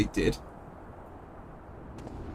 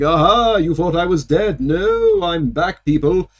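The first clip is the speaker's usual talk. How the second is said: gloating returning dragon; 2 semitones higher, much slower than usual. Pretend.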